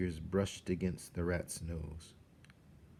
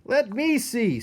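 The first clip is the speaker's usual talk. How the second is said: high loud jostling rat voices; eager rats; 9 dB louder, slower than usual. A narrator's reading. Rats shove forward to see the kill. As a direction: crowd in greedily. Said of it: "said the other rats, pushing and shoving".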